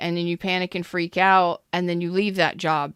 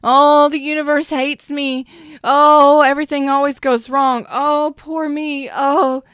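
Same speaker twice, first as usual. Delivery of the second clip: loud, high-pitched mock woe-is-me lament; overplayed victim wailing at a raised pitch; exaggerated self-pity; 8 semitones higher, 9 dB louder than usual. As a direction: pile on the poor-me complaints, hammed-up. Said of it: "I'm putting emphasis on this".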